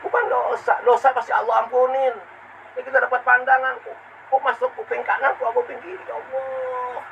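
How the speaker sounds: high-pitched, pleading sermon voice; impassioned preaching. Acted. Preacher lamenting unheeded guidance, impassioned. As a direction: plead upward, ending in a thin wail.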